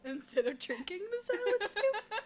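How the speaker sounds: high, laughing through the words; can't hold back a giggle. Real, not acted. Laughing at the girlfriend's text.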